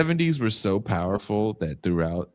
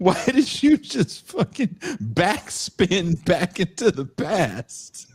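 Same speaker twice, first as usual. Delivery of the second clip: laughing; 12 semitones higher, 3 dB louder, much slower than usual. Real, not acted. Laughing mock disbelief.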